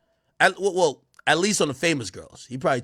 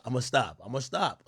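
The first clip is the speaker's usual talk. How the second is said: ordinary delivery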